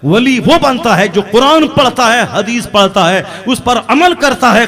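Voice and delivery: loud, high preacher's emphasis; forceful preaching. Real, not acted. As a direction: drive each condition home to the congregation. (ज़ोरदार, ऊँची आवाज़ में तक़रीर)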